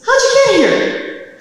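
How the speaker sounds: high, loud, stretched-out disbelief; astonished yell. Retold or imitated. Husband finding his wife at the computer, astonished yell. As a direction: imitate a stunned husband shrieking the question.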